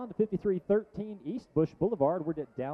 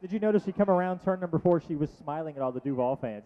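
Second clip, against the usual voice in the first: usual speaking voice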